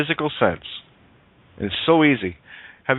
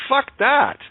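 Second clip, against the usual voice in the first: mocking defiance; 9 semitones higher, slower than usual. Real, not acted. Mocking the saying.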